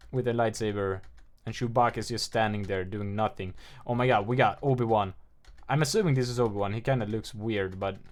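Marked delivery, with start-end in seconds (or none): none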